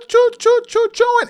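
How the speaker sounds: high piping choo choo like a train; playful train sound; train whistle imitation.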